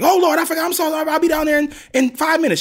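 put-on high, loud panicked old lady; a frantic grandmother forgetting to pick up the kid; panicked grandma. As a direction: squeal it out in a panic, apologizing. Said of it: "like, panicked".

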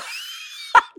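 high, breathless mock sob; imitated crying. Mother unable to talk when family comes home, mock sobbing. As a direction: act out helpless bawling for the story. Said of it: "just crying and so sad".

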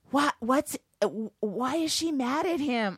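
high, voiced question from someone missing the whisper; failed whisper. Son asks parent about the show, failing to whisper. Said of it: "he doesn't pick up. That's how you whisper".

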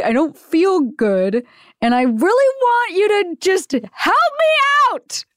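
slow, high, pitiful whine; hammed-up poor-me plea; mock whiny. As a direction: play sick and sorry for yourself. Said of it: "I don't know why I'm so upset today".

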